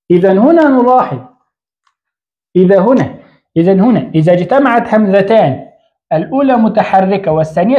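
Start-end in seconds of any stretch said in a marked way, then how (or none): none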